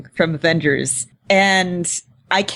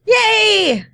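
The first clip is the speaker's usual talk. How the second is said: high, loud yay; excited cheer; 3 dB louder than usual. Real, not acted. Cheering casting news.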